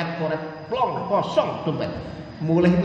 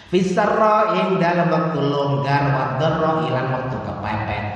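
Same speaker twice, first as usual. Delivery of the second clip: forceful preaching; 2 semitones higher, 9 dB louder than usual. Acted. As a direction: drive the verse home to the congregation.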